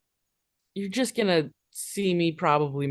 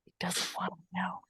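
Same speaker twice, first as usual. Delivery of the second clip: breathy whispered aside; 3 semitones higher, 11 dB quieter than usual. Real, not acted. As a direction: whisper it under your breath. Whispered aside about character.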